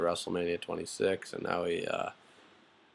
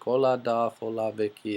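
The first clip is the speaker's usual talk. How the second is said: lightly voiced Rosa, higher and louder at the start; cheery hola greeting; 3 semitones higher, 4 dB louder than usual. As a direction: sing out the hellos as a passing girl. Rosa greets Dolph and Vicky.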